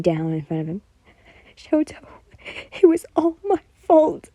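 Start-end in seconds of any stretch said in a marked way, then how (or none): 1.6-4.3 high loud crying; between sobs. A narrator's reading. Yen crying to Shoto, sobbing out the confession. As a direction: break down sobbing into the words. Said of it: "you said between sobs".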